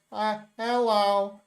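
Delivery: slow, lightly put-on clone voice; hint of an odd, stretched-out greeting; clone junior. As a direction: play a slightly off copy saying hello. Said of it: "And Junior's like".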